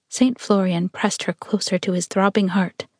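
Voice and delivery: ordinary delivery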